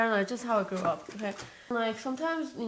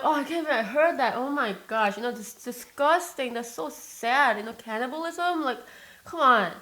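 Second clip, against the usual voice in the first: loud, fast, distressed cry of disbelief; upset outburst; 4 semitones higher, 5 dB louder than usual. Retold or imitated. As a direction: blurt it out, horrified by what you heard. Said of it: "I'm like".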